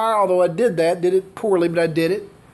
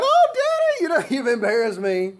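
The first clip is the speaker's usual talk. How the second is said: put-on high squealing girl's whine, much higher; mad teenage daughter; 6 semitones higher than usual. Daughter scolding her dad over the date, high whiny pout. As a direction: play the huffy daughter, high and pouty. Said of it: "And she got all mad".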